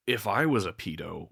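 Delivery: usual speaking voice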